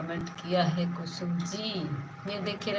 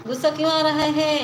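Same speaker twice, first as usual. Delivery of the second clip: higher, louder, pressing why; loud demanding question; 6 semitones higher, 8 dB louder, faster than usual. Real, not acted. Streamer presses Ramesh bhai why he's angry, loud demand. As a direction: push the question up and out hard.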